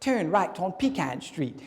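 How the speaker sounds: mock British navigation voice; slow, measured British directions; British GPS fella. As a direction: imitate an English GPS voice. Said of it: "they got this British fella in there".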